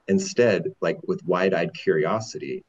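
ordinary talk

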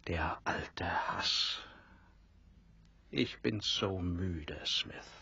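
slow, faint and breathless; dying whisper. Acted. Dying captain confiding in Smith, slow fading whisper. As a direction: let the words fade with the last strength.

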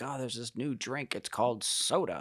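put-on old-fashioned announcer voice; hammed-up old-time salesman; old-timey voice. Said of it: "That's your, like, old-timey voice".

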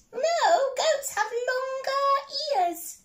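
high, slow squeaky mouse voice; little mouse. Acted. Mouse answers the troll under the bridge, high squeak. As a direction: squeak each word out slowly.

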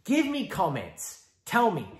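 usual speaking voice

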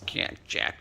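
breathy bird-like "cek cek"; monkey chirp imitation. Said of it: "Öyle bir ses".

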